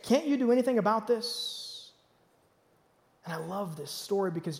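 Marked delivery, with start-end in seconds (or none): 0.0-1.9 questioning accusation. Retold or imitated. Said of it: "They're questioning his ability".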